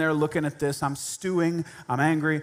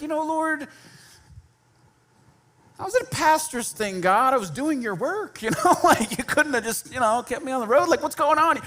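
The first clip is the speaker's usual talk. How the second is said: higher, exasperated griping at God; mock-complaining to the Lord; whiny plea to God, half in jest; frustrated prayer; 9 semitones higher than usual. Retells his angry prayer to God.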